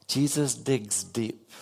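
normal talking voice

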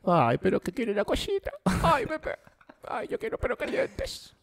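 put-on high, soft, slow cooing baby voice; baby talk. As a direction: coo to your girlfriend like a baby. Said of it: "el hombre que habla como bebé".

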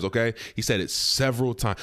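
normal talking voice

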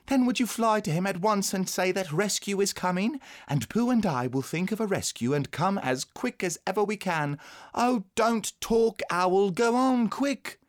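put-on high, insistent child's voice; boy giving eager orders, high; urgent young boy. A narrator's reading. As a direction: hurry a dawdling friend along, high-pitched.

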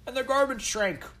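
high, teasing Tudor Londoner; mocking onlooker. A narrator's reading. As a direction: tease the children in a high old-time voice. Second onlooker jeers.

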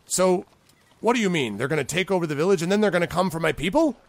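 worried boss demanding answers; goblin boss, alarmed. Acted.

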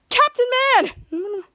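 high, loud acted call of joy; mock delighted shout, dropping at the end; happy cry. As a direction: imitate a kid hero overjoyed to see a friend. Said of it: "being so happy".

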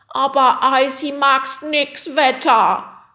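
high, loud, drawn-out creature whine; defiant yowl. Acted. Yeti rejects the weather programme, defiant yowl. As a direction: wail it like a sulking yeti. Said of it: "jaulte der Yeti trotzig".

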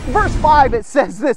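ordinary talk, nothing marked

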